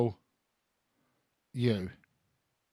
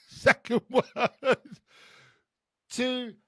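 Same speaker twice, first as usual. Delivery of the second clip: higher, louder, drawn out slowly; sing-song announcement; 8 semitones higher, 3 dB louder, slower than usual. Acted. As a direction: stretch it out like a game-show host. Charades clue, sung-out announcement.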